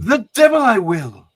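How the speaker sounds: put-on a Scottish accent that spits back defiance; defiant Scottish accent. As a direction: Moira defying Magneto in a Scottish accent. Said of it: "the compliment on the Scottish accent".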